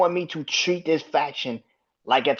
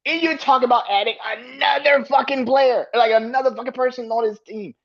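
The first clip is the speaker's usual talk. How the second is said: heated shout; 7 semitones higher than usual. Real, not acted. Host shouting at plans to add another player. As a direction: call out the bookers.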